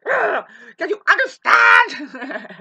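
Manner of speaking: high, slow, strained scream; desperate shout. Acted. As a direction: roar it as a frantic demand. Acts desperate Aries grabbing someone.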